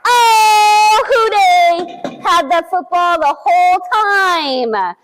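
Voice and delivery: high, loud cry of delight; excited cheer. Acted. Cheering to the children as the football turns up. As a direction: celebrate the big reveal for the kids. Football found under Houday.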